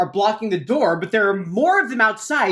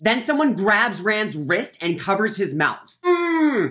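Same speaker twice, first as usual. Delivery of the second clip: ordinary talk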